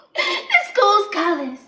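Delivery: high, slow, giggly pride; giddy delight. Acted. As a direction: say it loud through a girlish giggle. Giggly delight about her bows.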